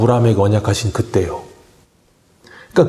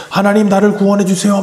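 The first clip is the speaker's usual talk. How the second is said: crying out to God; 7 semitones higher than usual. Retold or imitated. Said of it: "막 부르짖는데".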